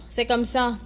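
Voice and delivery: usual speaking voice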